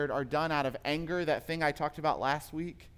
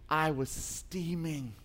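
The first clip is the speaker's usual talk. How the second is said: steaming; 3 dB quieter, slower than usual. Retold or imitated. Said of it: "she's like".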